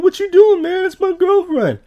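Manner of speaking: loud, squeaky mock protest; hammed-up squeal of objection; high-pitched funny voice. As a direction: imitate an outraged boyfriend way up high. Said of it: "a funny voice in this episode".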